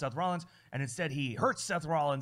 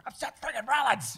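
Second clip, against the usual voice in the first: hyped wrestler shout; 9 semitones higher than usual. Acted. Host mimics a wrestler's triumphant comeback, hyped-up shout. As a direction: bellow it like a comeback catchphrase.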